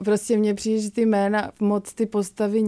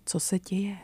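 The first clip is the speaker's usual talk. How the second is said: half-whispered question, dropped soft; a narrator murmuring a hushed question; 6 dB quieter than usual. A narrator's reading. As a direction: ask it softly so no one hears. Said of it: "šeptám".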